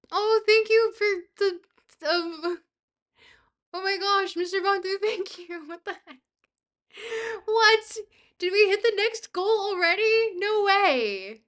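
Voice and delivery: high, loud, disbelieving gratitude; stunned excitement. Real, not acted. Streamer reacts to a big gift, stunned excitement. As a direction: blurt it out, thrilled and astonished.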